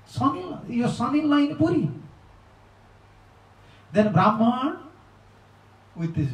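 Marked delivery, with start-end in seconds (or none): 0.1-1.9 high, quick announcement; eager news. Retold or imitated. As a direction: blurt out the news, high and fast. Villagers announcing the son-in-law.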